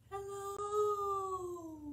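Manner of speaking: very high held hello. Shown as a demonstration. Librarian singing hello high for the kids, high. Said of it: "as high as we can".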